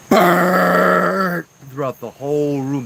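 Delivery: loud, slow, drawn-out rumbling noise; imitated deep rumble. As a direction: rumble out the generator's roar. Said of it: "this loud, like, deep".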